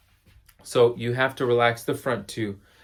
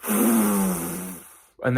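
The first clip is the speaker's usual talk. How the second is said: blowing out through pursed lips; 5 semitones lower than usual. Shown as a demonstration. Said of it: "blow out the same way".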